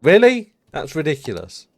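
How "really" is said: sharp high disbelieving "really"; surprised. Real, not acted. Streamer reacting to a chat stat, surprised. Reacting to chat's commute number.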